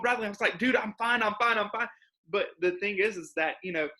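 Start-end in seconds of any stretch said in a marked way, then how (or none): 0.6-1.9 high-pitched, repeated protest to a worried friend; retold insistent reassurance. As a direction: act out insisting you're okay.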